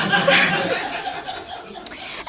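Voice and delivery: unvoiced high giggle at her own joke; breathy laugh. Real, not acted. Storyteller laughing at her own embarrassing memory, breathy giggle. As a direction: let a breathy laugh escape.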